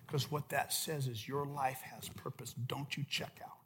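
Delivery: soft, low, intimate sermon voice; hushed preaching. Acted. Preacher quietly urging each disciple, hushed and intimate. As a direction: lean in and speak softly to each listener.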